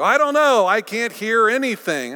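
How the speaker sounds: frustrated. Retold or imitated. Retold frustrated counselee.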